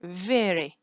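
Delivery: "Very" with a quick tapped R; "Very" stressed on the first syllable, R flapped. Shown as a demonstration. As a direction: tap the R once between the vowels of "Very". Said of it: "So you use the R sound".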